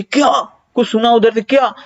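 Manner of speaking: high, startled क्या? into a phone; a hammed-up filmi "क्या?" on the phone; movie-style phone shock. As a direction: act the shocked movie caller hearing news. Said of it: "movie में देखते".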